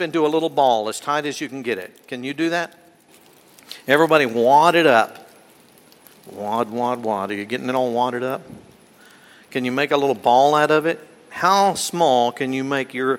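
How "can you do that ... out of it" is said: ordinary talk, nothing marked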